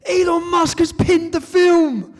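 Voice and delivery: put-on high, loud, slow child's announcement; excited son. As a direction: mimic a kid bursting with news. Son delivers big news.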